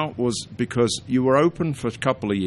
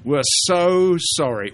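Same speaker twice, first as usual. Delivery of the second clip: higher, slow mock-grovelling apology; hammed-up sorry in a higher voice; Monty Python apology; 7 semitones higher, 4 dB louder than usual. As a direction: lay the apology on thick, Python style. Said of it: "that's a Monty Python thing".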